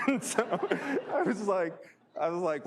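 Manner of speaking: high, loud, laughing through the words; laughter breaking into the telling; real laughing. Real, not acted. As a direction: crack up while trying to continue the story. Laughs at own imitation of caller.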